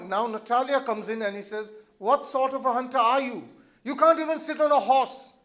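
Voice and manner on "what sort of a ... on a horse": loud, high-pitched acted taunt; poking fun. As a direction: jeer at the feeble hunter. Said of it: "she is still poking fun at him".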